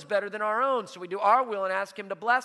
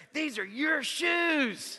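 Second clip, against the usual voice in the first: slow, higher-pitched retold exclamation; retold amazement; 7 semitones higher, much slower than usual. Homeless man to pastor about the shoes, retold amazement. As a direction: draw it out in astonished recognition.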